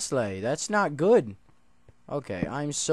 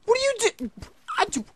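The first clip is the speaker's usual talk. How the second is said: high shocked yelling; startled outcry; 11 dB louder than usual. Real, not acted. Player seeing a zombie up on the roof, shocked shouting. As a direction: shout in disbelief.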